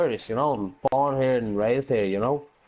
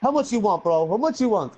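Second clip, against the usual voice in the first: a touch of an eager street dealer; mock eager dealer; 7 semitones higher than usual. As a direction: ham up a keen hustler. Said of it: "He says".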